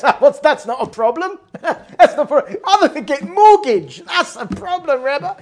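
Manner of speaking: loud, high, laughing cabbie protest; mock-indignant cabbie arguing through laughter; exasperated cabbie impersonation. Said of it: "He, like, totally actually goes".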